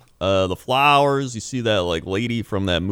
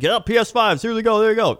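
higher, bright mock salesman; cheery store voice; 11 semitones higher than usual. Mocking GameStop offering consoles, high and cheery. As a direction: hand them out like a game show host. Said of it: "GameStop's like".

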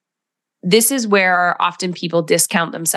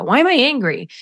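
self-questioning; 6 semitones higher, faster than usual. Retold or imitated. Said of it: "and go".

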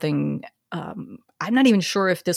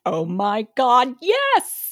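imitating a thrilled friend, high-pitched yes; drawn-out delighted "oh my God"; excited reply; 5 semitones higher, 4 dB louder, slower than usual. Retelling Kate's delighted reply.

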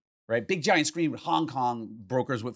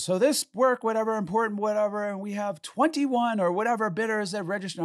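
higher-pitched auctioneer patter; 9 semitones higher than usual. Retold or imitated. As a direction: imitate an announcer rattling off the lot. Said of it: "the announcer says".

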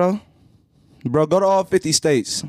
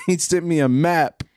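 normal talking voice

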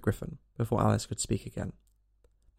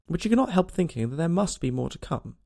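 plain reading voice

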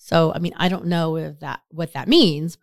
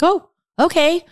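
high, drawn-out surprised "oh, okay"; a pleasantly caught-off-guard okay; 11 semitones higher than usual. Retold or imitated. Surprised reply to invitation.

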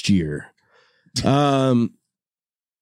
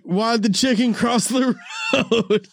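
a raised, giggly punchline setup; joking riddle; 9 semitones higher than usual. Retold or imitated. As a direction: toss out the riddle with a laugh breaking in. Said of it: "I was like".

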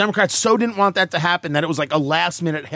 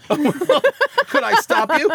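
laughing; 10 semitones higher, much slower than usual. Real, not acted. Laughing interruption.

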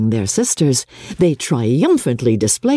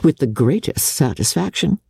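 ordinary delivery

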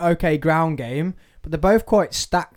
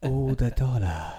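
lower, softer, slow mock greedy line; mock money-hungry mumble; 4 semitones lower, 6 dB quieter than usual. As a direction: play the mate chasing money. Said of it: "How can you go from that?".

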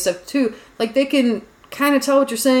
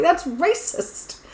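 mock scolding friends; 8 semitones higher, 4 dB quieter, much slower than usual. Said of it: "They're like".

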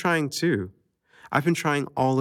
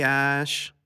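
usual speaking voice

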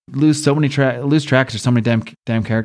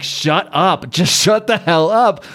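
mock-exasperated, higher and slow; drawn-out, raised-pitch mock outburst; exasperated retort; 4 semitones higher, slower than usual. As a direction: imitate someone cutting off a rant. Exasperated character cuts off rant.

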